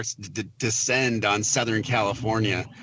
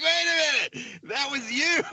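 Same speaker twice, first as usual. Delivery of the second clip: much higher, sudden dawning surprise; stunned realization. Retold or imitated. Recalling to the host the moment he realized Jonathan caused the heat wave, much higher stunned surprise. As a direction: catch on all at once, amazed.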